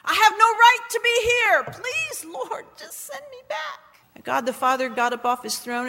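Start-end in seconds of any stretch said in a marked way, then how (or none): 0.0-3.8 high, drawn-out retold plea; acted desperate pleading, trailing away; crying out. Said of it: "I was crying out and saying".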